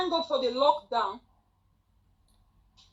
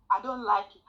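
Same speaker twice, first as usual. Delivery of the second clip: ordinary talk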